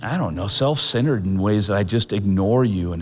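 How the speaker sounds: steady, earnest prayer voice over music; guided prayer. Acted. Pastor leading the congregation in prayer, earnest and steady. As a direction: lead them gently through a prayer of trust.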